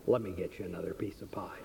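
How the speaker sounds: whispered offer. Retold or imitated. Waitress quietly offers new pie.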